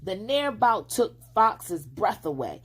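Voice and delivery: ordinary delivery, nothing marked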